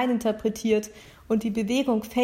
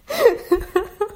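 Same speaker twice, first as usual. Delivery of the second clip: high, louder burst of laughter; real giggle fit; 11 semitones higher, 5 dB louder than usual. Real, not acted. Reader cracks up after fumbling the sentence, real laughter. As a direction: crack up helplessly at your own slip.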